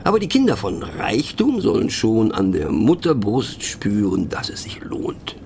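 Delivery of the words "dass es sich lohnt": breathy whispered punchline; a sly hushed aside. Acted. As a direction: drop it to a conspiratorial whisper.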